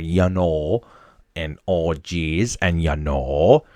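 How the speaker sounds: imitating sister's Wisconsin accent, long o in know; thick Wisconsin accent. Mimicking his sister for listeners, Wisconsin accent. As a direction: Wisconsin vowels, "Oh, geez" drawn out. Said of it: "thick Wisconsin accent".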